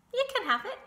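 very high, loud brush-off; dismissive. A narrator's reading. Goose giving Templeton the dud egg, dismissive.

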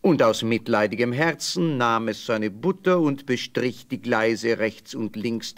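usual speaking voice

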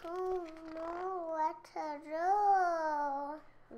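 very slow, stretched question; slow drawn-out. Real, not acted. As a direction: drag every word out. Child draws out the line.

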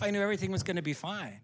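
normal talking voice

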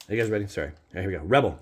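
usual speaking voice

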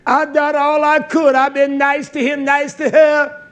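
high, loud protest; acted self-justifying complaint. The people justify themselves to Yah, acted self-justifying complaint. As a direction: plead your own case in a preacher's shout. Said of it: "They say".

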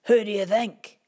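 loud, gruff wolfy growl; a hint of a gruff wolf. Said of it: "said a gruff, wolfy kind of voice".

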